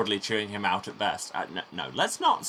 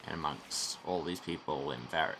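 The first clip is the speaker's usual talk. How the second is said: ordinary delivery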